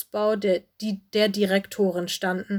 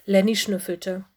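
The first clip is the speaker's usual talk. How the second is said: usual speaking voice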